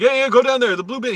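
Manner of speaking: hurried yelling. Retold or imitated. Hurried security guard yelling at the driver, loud. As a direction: wave him off with a loud hurry. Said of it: "getting really hurried".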